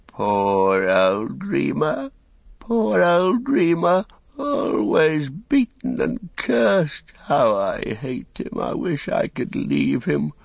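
high, slow, whining creature voice; whimpering Wormtongue. A narrator's reading. Wormtongue whimpering about Saruman to the company. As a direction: whimper it out like a beaten cringing servant.

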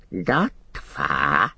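strange creature call, leaping very high; Gollum creature voice. A narrator's reading. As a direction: call it in an eerie, squeaky creature voice. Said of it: "said a voice above him".

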